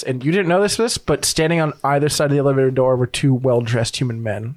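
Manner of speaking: normal talking voice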